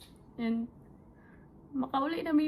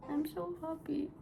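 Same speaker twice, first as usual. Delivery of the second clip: crying happily; 5 semitones higher than usual. Real, not acted. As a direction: squeeze it out through happy tears. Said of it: "this is my first time crying".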